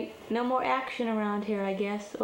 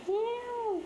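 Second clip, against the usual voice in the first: meowing; 13 semitones higher than usual. Pretend.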